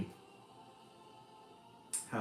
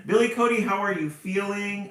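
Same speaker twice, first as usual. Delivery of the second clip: much higher and louder, sing-song greeting; excited call-out; 11 semitones higher, 11 dB louder than usual. Real, not acted. Host calls out to a returning viewer, high and loud.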